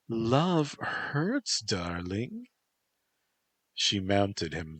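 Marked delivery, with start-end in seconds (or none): 0.1-2.5 high, coaxing female voice; Toko, teasing lover. Acted. Toko teasing her captive boyfriend, high coaxing voice.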